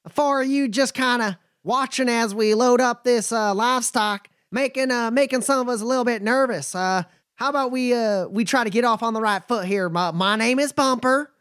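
a wary but friendly trail boss sizing folks up; nomad Bumper. Acted.

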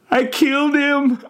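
very high, loud wail; tormented old man's cry; anguished confession. A narrator's reading. Agonised old man confesses.

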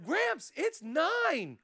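high, slow, drawn-out mock disbelief; incredulous grandchild. Grandchild doubting grandpa's late errand, high slow disbelief. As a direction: question it like a doubting kid, stretched and high.

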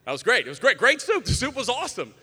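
loud, high burst of overdone praise; mock gushing. Diner raving about a tiny soup, hammed-up excitement.

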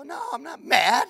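high, loud, mock-indignant denial; hammed-up defensive outburst; insisting not mad. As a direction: protest way too hard that you're not mad. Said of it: "Man, you seem mad sometimes".

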